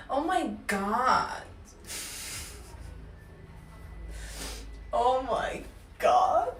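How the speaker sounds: slow, stretched-out Valley girl attempt. Russian speaker practicing from a tutorial, exaggerated Valley girl. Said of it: "How to totally sound like a Valley girl".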